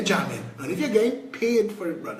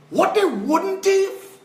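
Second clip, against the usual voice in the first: indignant repeat; 11 semitones higher, slower than usual. Real, not acted. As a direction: stretch it out in disbelief. Repeats question more forcefully.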